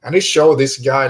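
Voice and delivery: normal talking voice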